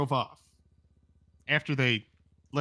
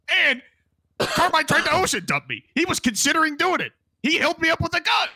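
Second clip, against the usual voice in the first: agitated; 11 semitones higher, 4 dB louder than usual. Real, not acted. Upset telling of betrayal.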